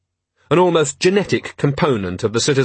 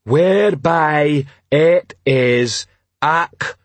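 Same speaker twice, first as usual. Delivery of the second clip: very slow, higher, broken into pieces; troll stumbling over reading; 4 semitones higher, much slower than usual. Acted. Troll struggling with the Riot Act, halting proclamation. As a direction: sound out the long word piece by piece.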